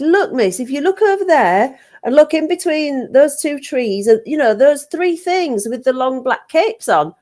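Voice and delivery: put-on a child insisting, urging her to see; insistent. Young pupil insisting the dinner lady look between the trees, insistent. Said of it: "I got really sort of insistent".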